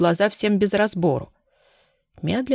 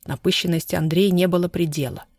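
ordinary delivery

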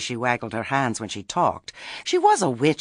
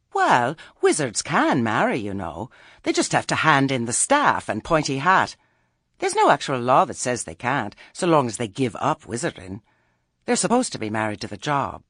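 higher, louder, reasonable explanation; placating Nanny; 4 semitones higher, 5 dB louder than usual. Acted. Nanny explaining to Granny that wizards marry, placating. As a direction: explain it to calm her down.

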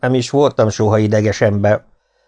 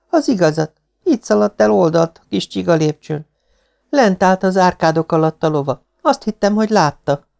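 put-on high-pitched girl's voice; insistent young woman; 8 semitones higher than usual. Girl tells the Marquis what she saw, insistent. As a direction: report it earnestly, voice raised in pitch.

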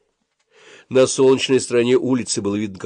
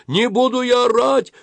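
bawling protest; 5 dB louder than usual. A narrator's reading. Suren refuses to shout by shouting, bawling. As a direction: bawl the refusal at full pitch. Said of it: "орал Сурен".

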